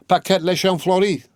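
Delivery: high-pitched call of a full name. A narrator's reading. Mahiette calling through the window to the recluse, high calling voice.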